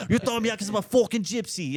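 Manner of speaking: high-pitched mock Irish protest; imitated irate Irishman. Drunk Irishman accusing the man ejecting him, high mock outrage. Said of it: "full-on Irish guy".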